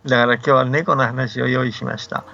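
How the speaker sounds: ordinary delivery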